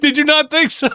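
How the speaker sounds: high-pitched, through laughter; can barely ask it for laughing; laughing hard. Real, not acted. Co-host teasing host who missed being live, laughing.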